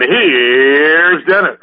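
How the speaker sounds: slow, drawn-out announcer voice, hammed up; teasing the host with a big on-air voice; mock radio voice. Teasing the host by copying his radio announcer voice, slow and grand. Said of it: "that was teasing".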